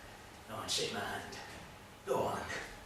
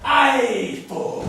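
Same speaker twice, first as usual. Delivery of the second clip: loud, high, combative; indignant comeback; angry retort; 8 semitones higher, 8 dB louder than usual. Acted. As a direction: throw it back loudly. Quarrel with the Frenchman.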